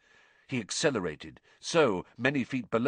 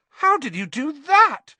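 high, loud, drawn-out squeal; 9 dB louder than usual. A narrator's reading. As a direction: squeal in amazed delight. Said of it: "squealed Angelina Johnson".

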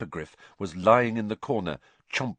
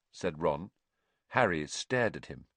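usual speaking voice

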